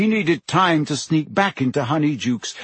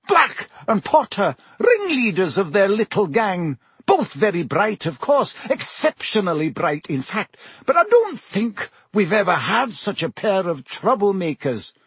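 higher-pitched schoolmistress voice; brisk professor, recollecting; 7 semitones higher than usual. A narrator's reading. Professor describes two former troublemakers, brisk and recollecting. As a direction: recount former pupils with authority.

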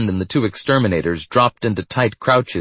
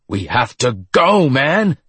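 urgent yell climbing high at the end; 5 semitones higher, 3 dB louder, slower than usual. A narrator's reading. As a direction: stretch it out, rising in panic. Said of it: "the scarred one yelled".

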